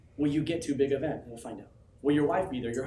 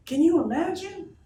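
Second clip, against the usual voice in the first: a slow, ringing, much higher cry; shouted; 14 semitones higher, 6 dB louder, slower than usual. Real, not acted. Hyping crowd about big event.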